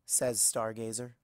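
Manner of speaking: ordinary delivery, nothing marked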